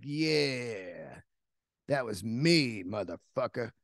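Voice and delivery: the killer spider gloating at its owner; smug tarantula. Retold or imitated. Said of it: "the other one's over in the corner like".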